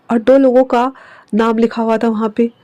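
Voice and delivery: ordinary delivery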